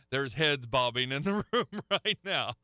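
chuckling through the words; amused laughing. Real, not acted. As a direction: let a real laugh break into the line. Amused at the audience's reaction.

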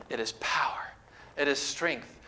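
hushed whisper of awe; a preacher whispering the point to his flock. Real, not acted. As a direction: breathe it out as a secret. Preacher drops to hushed emphasis.

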